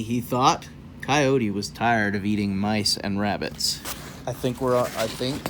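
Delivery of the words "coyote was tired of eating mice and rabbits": ordinary delivery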